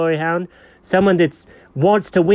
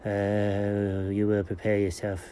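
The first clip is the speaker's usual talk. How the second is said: "will" said much softer and lower; low murmur; 9 semitones lower, 11 dB quieter, slower than usual. Shown as a demonstration. Repeating the order to the dog, low and soft. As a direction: mutter "you will prepare yourself" under the breath. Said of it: "So you will prepare yourself".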